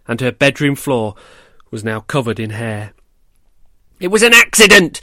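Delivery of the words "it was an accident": high, loud desperate scream. A narrator's reading. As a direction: scream it out in protest. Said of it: "she screamed at her spiteful big brother".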